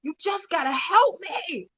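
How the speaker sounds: high-pitched cry to God, no real scream; mock scream held at talking volume; retold scream. Wife begging God for help in the laundry room, retold scream. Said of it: "I just screamed".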